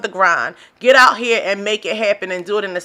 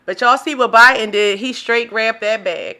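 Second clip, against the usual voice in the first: ordinary talk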